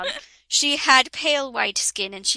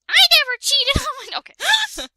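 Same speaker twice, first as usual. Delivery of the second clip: far higher, fast indignant squeal; shrill mock protest; 5 dB louder than usual. Heroine denying cheating, high mock squeal. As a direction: squeal the denial, much higher and quicker.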